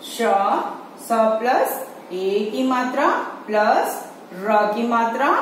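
slow, letter by letter; teacher sounding out letters slowly; slow spelling out. Shown as a demonstration. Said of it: "फिर से मैं repeat कर रही हूँ".

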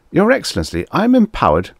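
very high-pitched, piping protest; flustered envoy. A narrator's reading. Magnan pleading his authority to Korn, high flustered protest. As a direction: protest high up in a fussy diplomat's voice.

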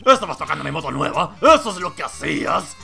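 acusación gritada. Acted. Owner accusing Nick.

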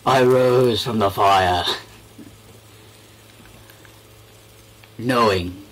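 slow dramatic hero voice; mock epic narration. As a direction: recite like a movie trailer.

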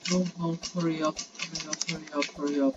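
very soft, hurried pleading; anxious urging. Real, not acted. Player urging on after a fall, hushed and anxious. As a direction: plead under your breath to hurry.

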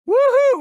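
loud high whoop; excited whoop. Acted. Announcer cheering a record run, loud high whoop. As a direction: belt out a joyful cheer.

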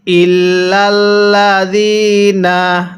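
ইল্লাল্লাযিনা chanted slowly, lam doubled, ya stretched one alif; tajweed recitation. Shown as a demonstration. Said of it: "হ্যাঁ, পড়ুন".

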